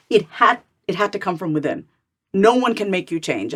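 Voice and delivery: ordinary talk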